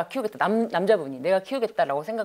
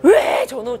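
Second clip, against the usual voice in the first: angry outburst; 5 semitones higher, 8 dB louder, much slower than usual. Retold or imitated. Mother-in-law yelling to police.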